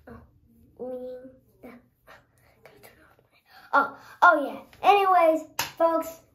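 ordinary talk

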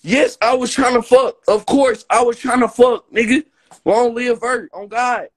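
loud, emphatic rant, pitch up; hollering it out to his live chat; shouting. Real, not acted. Streamer yelling at his viewers, loud and emphatic. Yelling about trying to hook up.

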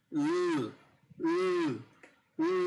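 "উ" as a held dark English l; English l demo. Shown as a demonstration. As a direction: hold "উ" with the tongue in l position, pause between each. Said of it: "sound হবে এরকম ল". ("উ"-কে ইংরেজি ল ধ্বনি হিসেবে টেনে বলা)

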